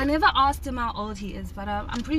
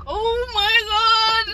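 high, loud, stretched-out shock; retold panic; 11 semitones higher, 6 dB louder, much slower than usual. Retelling the surprise kiss to friends, mock panicked. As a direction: draw it out in high-pitched horror. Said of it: "I was in a panic".

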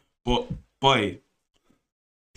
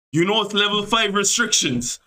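higher-pitched mock-official reproach; challenging guard; 7 semitones higher than usual. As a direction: imitate an officer pulling them up on rules. Acts out guard challenging their walk.

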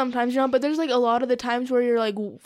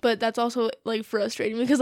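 usual speaking voice

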